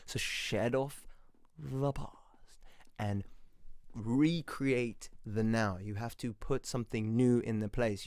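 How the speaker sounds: normal talking voice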